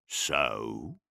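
low guttural orc question; deep brute's prompt. A narrator's reading. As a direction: ask it low, like an orc warlord. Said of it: "His Gothic was guttural".

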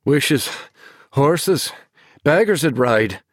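a hint of breathless teasing; panting. A narrator's reading. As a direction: tease her back between breaths. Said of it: "he panted back".

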